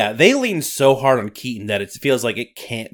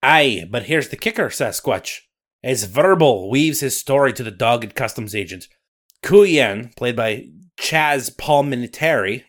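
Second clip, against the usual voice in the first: louder Scottish storyteller voice; Scottish accent; 3 dB louder than usual. Acted. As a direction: narrate like a Highland storyteller. Nessie narration.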